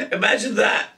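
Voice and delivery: high laugh then a bright exclamation; delighted laugh. A narrator's reading. Young man laughs, delighted.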